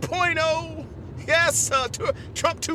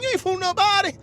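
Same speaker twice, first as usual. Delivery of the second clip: ordinary talk, nothing marked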